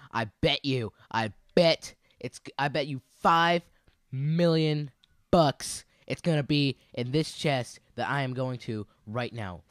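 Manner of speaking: showy confident hype; hyped bet. Real, not acted. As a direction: drag out the big bet. Hyped betting.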